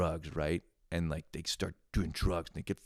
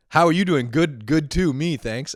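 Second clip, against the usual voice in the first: much higher and louder mock robotic answer; garbled bot reply; 9 semitones higher, 10 dB louder than usual. Mocking a Tinder bot's nonsense reply, high and loud. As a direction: parody a glitchy chatbot.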